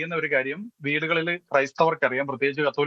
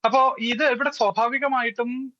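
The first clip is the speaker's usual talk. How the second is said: ordinary delivery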